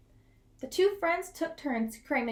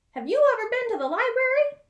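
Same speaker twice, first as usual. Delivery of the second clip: much higher, louder curious animal; Porcupine voice; 10 semitones higher, 7 dB louder, faster than usual. Acted. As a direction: ask it in a squeaky raised voice. Porcupine's voice in story.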